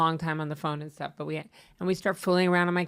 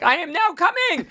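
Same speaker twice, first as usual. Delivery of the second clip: mock announcement; 12 semitones higher than usual. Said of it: "He's like".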